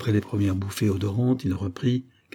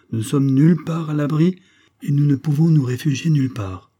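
vehement insistence; 6 semitones higher, 5 dB louder than usual. A narrator's reading. Vehement refusal, fatal law.

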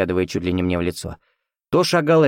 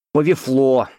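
mumbled with mouth full; 4 semitones higher, 4 dB louder, slower than usual. Acted. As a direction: chew the words. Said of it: "прошамкал парень".

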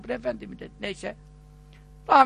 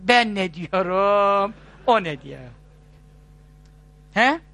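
mock grumbling; 2 semitones lower, slower than usual. Petitioner grumbling on the way out the door, mock exasperated. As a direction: mutter it like a disappointed petitioner.

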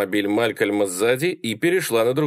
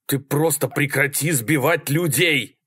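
higher-pitched shouted demand; moderately raised, urgent shout; exasperated yell; 5 semitones higher than usual. A narrator's reading. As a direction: yell it at a reckless driver. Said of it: "заорал Малькольм".

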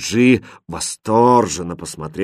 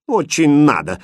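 higher, louder pert reply; cheeky retort; 5 semitones higher, 4 dB louder than usual. A narrator's reading. Princess defying her governess, cheekily. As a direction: snub with insolent lift. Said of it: "дерзко ответила принцесса".

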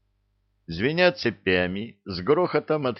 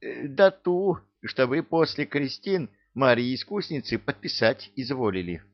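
high-pitched folksy voice; explaining servant; 6 semitones higher than usual. A narrator's reading. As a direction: remind the boss deferentially, voice up high. Servant figure reminds the tsar.

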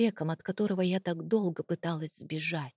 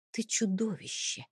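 softly voiced accusation, holding back; quiet, barely controlled; 2 semitones higher, 5 dB quieter than usual. A narrator's reading. Wife accusing her husband through held-back tears, quiet. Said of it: "тихо произнесла я".

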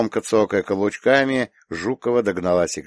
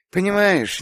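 uncertain, higher-pitched start; hesitant; 12 semitones higher than usual. A narrator's reading. Woman starts confiding in little girl, hesitant. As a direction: begin as if unsure how to say it. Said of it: "неуверенно вытолкнула Гарина".

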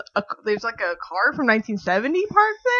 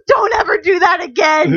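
louder shout; scolding shout; 6 semitones higher, 5 dB louder than usual. Retold or imitated. Friend Erica scolding her after the scare, shouting.

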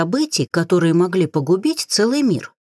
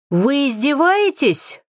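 slow, higher indignant roar; 8 semitones higher, slower than usual. A narrator's reading. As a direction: roar at the healer, outraged. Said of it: "взревел лорд Витер".

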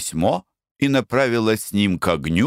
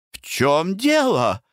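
high, slowed alarmed cry; alarmed exclamation; 8 semitones higher, slower than usual. A narrator's reading. Blanche pale, alarmed by her mother's reaction. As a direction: ask in sudden alarm. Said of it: "воскликнула Бланш".

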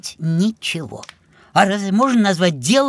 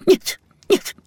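agitated refusal; 11 semitones higher than usual. Acted. Refusing the tempting present.

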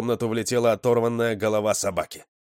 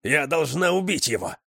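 higher, fierce insistence; growling anger; 7 semitones higher than usual. A narrator's reading. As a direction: snarl it with murderous intent. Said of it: "зарычала она". (злобно, с рыком)

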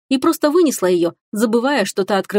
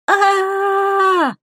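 long, high, sustained "aaah"; drawn-out high cry of horror, acted; terrified scream; 12 semitones higher than usual. A narrator's reading. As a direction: scream in fright, held on one high note. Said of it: "закричала Теруми".

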